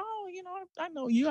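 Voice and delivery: high, soft acted fan response; reply softly in a lifted, slightly starstruck voice. Hotel manager meets a famous quarterback, softly starstruck.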